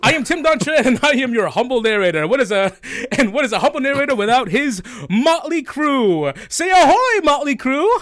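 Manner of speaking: high, loud theatrical host announcement; booming, high-pitched rally to the crew; grand show intro. Acted.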